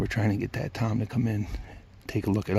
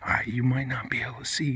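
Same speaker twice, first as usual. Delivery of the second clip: normal talking voice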